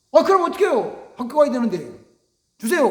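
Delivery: put-on insistent begging child; whiny child. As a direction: nag for money like a pushy kid. Child demanding money from father.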